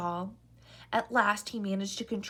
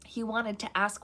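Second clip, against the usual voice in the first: ordinary delivery, nothing marked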